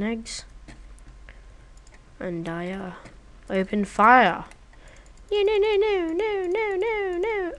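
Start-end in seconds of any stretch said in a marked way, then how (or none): 5.3-7.5 much higher panicked string of no. Real, not acted. Watching the chicken escape, panicked high cries. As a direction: yelp it in rising panic.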